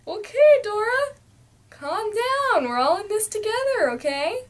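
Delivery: high, pleading plea to calm down. Real, not acted. Vlogger begs cartoon Dora to calm down, high and pleading. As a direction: raise the pitch and beg her to chill.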